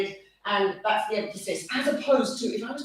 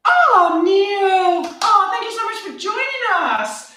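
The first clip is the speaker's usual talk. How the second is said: delighted greeting; 8 semitones higher, 8 dB louder, slower than usual. Real, not acted. Greeting a viewer in the chat.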